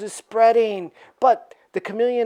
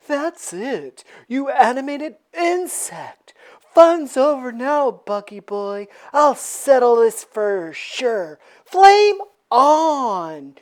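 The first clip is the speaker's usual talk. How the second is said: slow, louder hothead voice; Human Torch; 4 semitones higher, 5 dB louder, slower than usual. Acted. Torch cornering Spider-Man, slow and louder. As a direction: draw it out then blast. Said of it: "The Human Torch ... and says".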